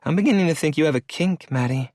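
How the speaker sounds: lightly voiced teasing woman; a hint of a playful young woman; female voice. A narrator's reading. Layton voiced by male narrator.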